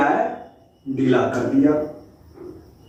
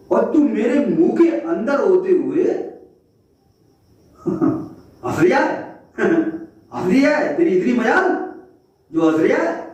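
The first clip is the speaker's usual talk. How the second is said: high, outraged lion voice; how dare you laugh, shout it down; furious king of the jungle; indignant lion; 7 semitones higher than usual. Acted. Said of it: "जिसे सिंह कहते हैं".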